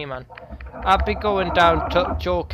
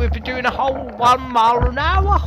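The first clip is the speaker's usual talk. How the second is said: higher, hammed-up plummy toff; exaggerated upper-class glee; mock posh English; 5 semitones higher than usual. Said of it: "let's talk all posh now".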